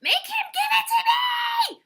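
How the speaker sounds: full-out, extremely high scream. A narrator's reading. Turtle appeals to the animals, screaming. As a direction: shriek the demand at top volume. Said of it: "screamed Turtle".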